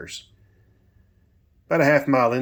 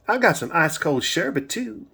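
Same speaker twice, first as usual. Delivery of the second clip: high-pitched, sing-song Southern lilt; Southern twang; 8 semitones higher than usual. A narrator's reading. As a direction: tempt him in a high Southern voice. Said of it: "that beautiful Southern twang".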